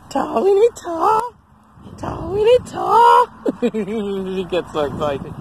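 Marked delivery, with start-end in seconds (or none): 0.1-3.3 high, insistent prompting of a dog; high-pitched doggy talk; playful pet coaxing. Real, not acted. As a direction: urge the dog to hand it over, high. Coaxing dog to hand over stick.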